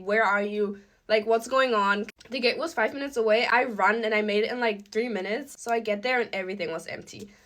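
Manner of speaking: usual speaking voice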